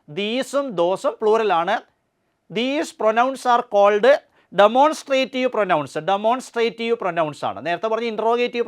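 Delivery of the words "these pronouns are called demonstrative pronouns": slow dictation. Shown as a demonstration. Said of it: "teacher states rule slowly".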